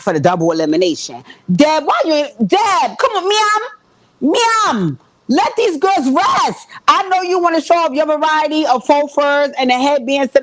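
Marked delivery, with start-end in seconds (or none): 1.5-8.7 exasperated plea. Pretend.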